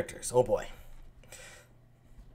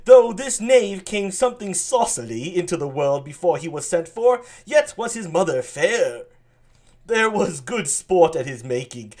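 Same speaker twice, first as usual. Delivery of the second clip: higher, louder dopey earl; dopey Gloucester; 7 semitones higher, 9 dB louder than usual. Acted. Gloucester introduces his son, higher and dopey. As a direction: play Gloucester as a bit of a dope.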